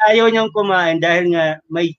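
ordinary talk

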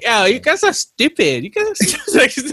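high-pitched mock telling-off; hammed-up scolding quote; imitated rant. Acting out the girl's angry rant.

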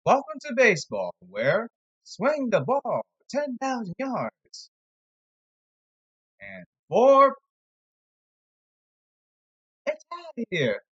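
high mocking announcer voice; sarcastic high sportscaster parody. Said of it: "I'm being sarcastic here".

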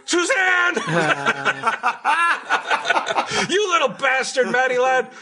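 put-on high, strained shouting as the older boyfriend; jealous old man shouting. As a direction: yell it like a jilted old guy. Acting jealous lover, shouting.

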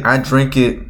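normal talking voice

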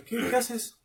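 lightly voiced cop, high pitch; imitated officer challenge. Voicing officer confronting her.

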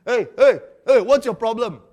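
loud, sharp, high defensive shout; defensive angry man. Acted. Defensive man snaps back loudly at someone correcting him. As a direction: bark it like a man caught in the wrong.

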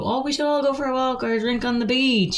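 high, put-on casual suggestion; mock-innocent high invitation; casual hinting. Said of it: "you know, trying to go".

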